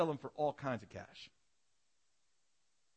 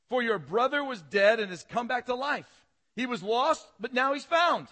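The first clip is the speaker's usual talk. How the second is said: loud, high-pitched sermon proclamation; preaching at full force; 10 semitones higher, 10 dB louder than usual. Acted. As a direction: proclaim the verse loudly to the congregation.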